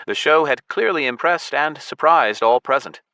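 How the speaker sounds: ordinary delivery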